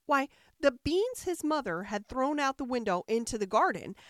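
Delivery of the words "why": plain reading voice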